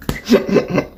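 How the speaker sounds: quick run of voiceless hiccup noises; involuntary little stuttered hiccups; hiccup tic. Real, not acted. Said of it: "you know the hiccup noise I make".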